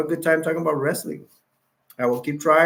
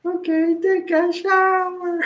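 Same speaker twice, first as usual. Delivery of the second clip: very high, slow squeaky ex-wife; puppy-like voice; slower than usual. Retold or imitated. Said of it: "her voice sounds like a little puppy".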